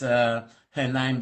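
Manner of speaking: normal talking voice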